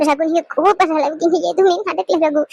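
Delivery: low, pleading through tears; repeated tearful begging. Acted. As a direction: beg him not to leave, near crying. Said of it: "தயவு செஞ்சு அழுகாத".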